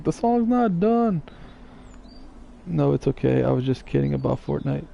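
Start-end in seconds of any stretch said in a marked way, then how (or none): 0.0-1.2 put-on whiny baby voice. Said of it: "She was like".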